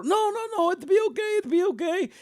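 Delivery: high sing-song no no it'll be okay; soothing guards. Retold or imitated. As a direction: coo like men soothing a crying woman. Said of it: "these guys come over".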